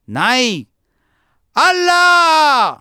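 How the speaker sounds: extremely high, loud, long-held scream; furious full-force correction, last word stretched out; gut-deep yell. A narrator's reading. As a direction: bellow it from the pit of your stomach. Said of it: "चौथ्यांदा बेंबीच्या देठापासून ओरडून म्हणाला".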